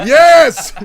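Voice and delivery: loud, high cheer; excited shout. Real, not acted. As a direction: yell it out in triumph. Cheering the Rat King.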